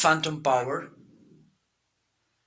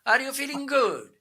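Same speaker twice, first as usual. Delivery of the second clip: mock Italian accent, pitched much higher; 10 semitones higher than usual. Shown as a demonstration. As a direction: keep the playful Italian accent going. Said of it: "I can speak with Italian accent".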